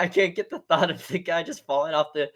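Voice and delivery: ordinary delivery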